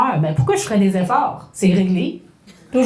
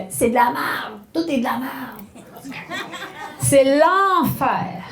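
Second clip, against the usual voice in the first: hammed-up despair, slow and laughing; mini dramatic show; 2 semitones higher, slower than usual. Shown as a demonstration. Teacher acting out the dramatic mini-show, hammed-up despair. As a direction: overdramatize everything like a tragedy. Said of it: "le mini-spectacle dramatique".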